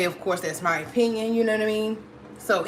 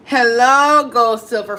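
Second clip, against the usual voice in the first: loud, raised greeting; calling out hello; 7 dB louder, slower than usual. Real, not acted. Host hailing a viewer who joins the live, loud greeting.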